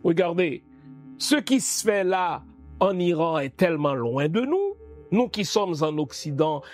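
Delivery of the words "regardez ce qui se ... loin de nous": ordinary talk, nothing marked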